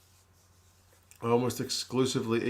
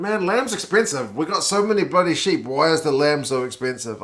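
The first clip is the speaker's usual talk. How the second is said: higher and louder, incredulous complaining; exasperated rant; 5 semitones higher, 7 dB louder than usual. Real, not acted. Streamer ranting to chat about lamb prices, exasperated and loud. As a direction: vent about the price like it's a personal insult.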